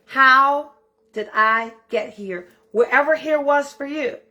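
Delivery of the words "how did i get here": HOW loud, then a slow drawn-out ask; a pondering read of the prompt; slow reflective question. Retold or imitated. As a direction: pose the journal question with weight. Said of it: "our journal entry was".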